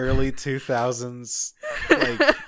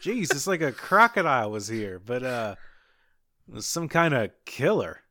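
ordinary talk

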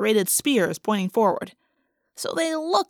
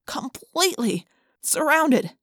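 usual speaking voice

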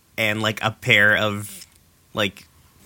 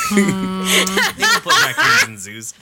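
laughing; 8 semitones higher, 3 dB louder, much slower than usual. Real, not acted. Laughing disbelief at raccoon plan.

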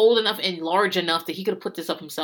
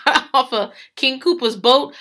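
normal talking voice

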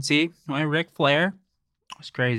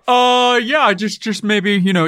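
booming character voice; 8 semitones higher, 6 dB louder than usual. Acted. Steven character voice again.

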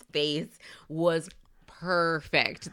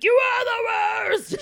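high, loud, slow yell; hammed-up angry yell at Hannah Ann; furious shout; 5 dB louder, slower than usual. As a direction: scream the accusation. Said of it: "she would have been like".

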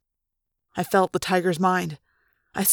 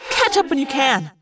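snapped; 6 semitones higher, 3 dB louder than usual. Acted. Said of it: "Rachel snapped".

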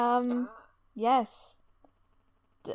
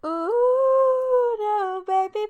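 mock heartbroken, high and loud; overacted don't-leave-me wail; hammed-up lover's plea; 6 semitones higher, 8 dB louder, slower than usual. Hammed-up lament.

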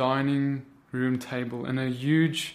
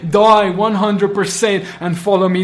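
loud, pleading preacher's cry; fiery preaching; 4 semitones higher, 8 dB louder than usual. Acted. Preacher urging brothers and sisters to choose, loud fiery sermon. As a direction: drive each question home at full force.

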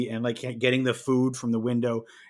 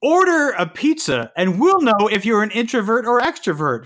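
a higher, louder hammed-up ad voice; mocking quiz pitch; 8 semitones higher, 6 dB louder than usual. Making fun of the pizza quiz with a mock ad pitch, higher and louder. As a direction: oversell it like a cheesy promo.

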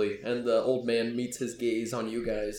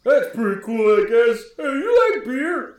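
loud, higher-pitched oafish enthusiasm; bumbling fellow; 8 semitones higher, 8 dB louder than usual. Acted. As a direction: play the big bumbling lug, booming and friendly. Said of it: "the bumbling fellow".

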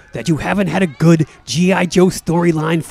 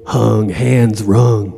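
brooding coma patient; 5 semitones lower than usual. Acted. Mister Roma drifting in his coma, brooding low. Character inner monologue in coma.

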